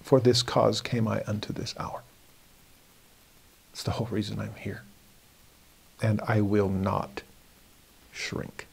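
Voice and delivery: hushed, slowing resolve; quiet determination. Acted. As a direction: sink softly into firm resolve. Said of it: "He's determined".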